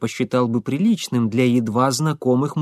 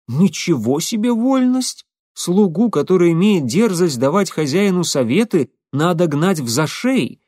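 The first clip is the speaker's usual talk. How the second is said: ordinary delivery, nothing marked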